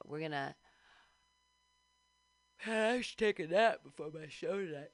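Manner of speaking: ordinary talk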